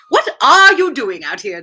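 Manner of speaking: British accent. Shown as a demonstration. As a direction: redo "What are you doing out here?" leaning on the R in "are" for a British sound. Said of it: "a little emphasis on the R".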